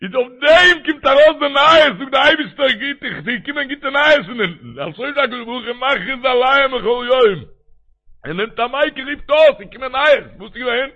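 high, fervent proclaiming; impassioned preaching. Acted. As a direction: cry it out from the pulpit, pitched well up. Preaches at full force.

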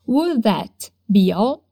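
slow, stress on would, rising at the end; slow drill. Shown as a demonstration. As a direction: spell the question out slowly.

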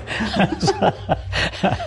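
a high, airy, voiceless laugh; breathy laugh. Real, not acted. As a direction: laugh softly at the memory. Laughs at father's illiteracy.

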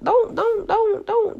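mock scolding. Mock scolding someone playing her.

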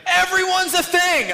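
high, slow, hammed-up proclamation; mock exclamation. Mocking the show's later seasons.

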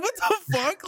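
high disbelieving squeak; hammed-up shock. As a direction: blurt it in mock disbelief. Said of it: "I'm like".

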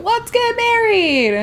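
high, slow lilting; mock sweet proposal. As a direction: say it like a sappy proposal. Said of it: "it's just like".

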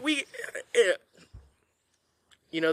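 ordinary talk

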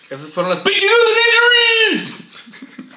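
big shouted sign-off; yelling across the room. Real, not acted. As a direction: belt it out like a radio host. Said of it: "Perdón que estoy gritando".

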